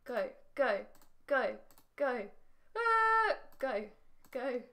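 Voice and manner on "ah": short, high, loud "ah!"; startled yelp. Real, not acted. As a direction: yelp in panic mid-game. Frustrated yelp while missing squares in game.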